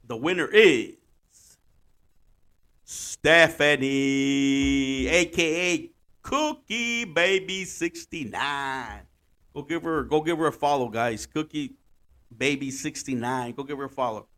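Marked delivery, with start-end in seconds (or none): 0.0-9.0 slow, dramatic reveal of name; winner announcement. Pretend. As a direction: announce it like a game show host.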